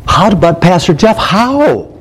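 mock plea, high drawn-out "how"; pleading congregant. Congregant begging Pastor Jeff for help, mock pleading. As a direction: act out a baffled churchgoer, pitch climbing. Voices a struggling congregant asking.

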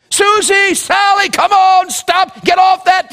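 high, loud calls to the dogs; yelling for dogs. Retold or imitated. Grandpa shouting to call his dogs back, high and loud. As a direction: shout the dogs' names across the valley. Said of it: "he yelled".

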